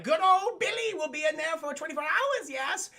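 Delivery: high, theatrical villain voice; shrill pompous sewer ruler; Sewer King voice. Retold or imitated. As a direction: hiss it like a creepy cult leader. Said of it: "And he's like".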